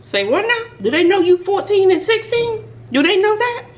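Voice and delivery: high, loud disbelief; outraged mom shock. Retold or imitated. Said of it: "I'm like".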